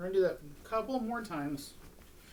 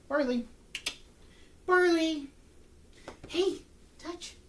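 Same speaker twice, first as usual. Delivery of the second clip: calling the dog much higher and louder; high puppy call; 13 semitones higher, 5 dB louder, much slower than usual. Real, not acted. Trainer calling her dog Barley to come touch, high and bright. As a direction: sing his name out high to pull him in.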